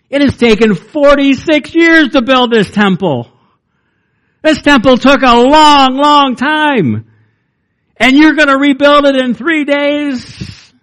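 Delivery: mock the absurd claim in disbelief; incredulous scoffing. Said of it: "that's how they responded".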